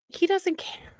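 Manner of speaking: whispered aside. Shown as a demonstration. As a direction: hush it like a secret aside. Contrasting whispered and plain takes.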